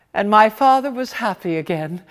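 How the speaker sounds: usual speaking voice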